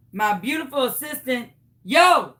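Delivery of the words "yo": loud, abrupt call. Real, not acted. Host summoning her beautiful assistant, loud call. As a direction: holler for someone's attention.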